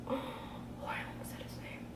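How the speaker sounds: gasped, barely voiced whisper; real whispered aside after a gasp; hushed slip-up. Real, not acted. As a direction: whisper it as if you nearly spilled a secret. Catches herself nearly revealing a name.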